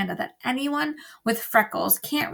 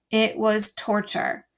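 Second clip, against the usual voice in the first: usual speaking voice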